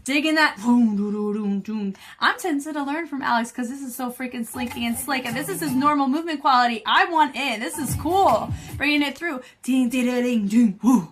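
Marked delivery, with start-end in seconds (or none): 0.6-1.9 vocalized riff. Retold or imitated. Reviewer digging that move, vocalizing the music. Sounding out the bass line. 9.6-11.1 rhythmic ding sounds capped with a woo; vocalized dings. Retold or imitated. Reviewer follows dancers bringing it through, vocalizing.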